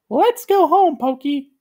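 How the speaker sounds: very high, loud cartoon plea; squeaky begging; Gumby's high voice. A narrator's reading. Gumby begs Pokey to go home, high voice. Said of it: "Gumby said".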